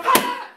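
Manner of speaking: cat attack cry. Retold or imitated. Cat attacks its own owner.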